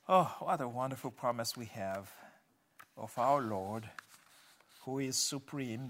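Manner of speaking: low, subdued praying voice; closing prayer. Real, not acted. Preacher thanking the Father for Revelation, low prayer.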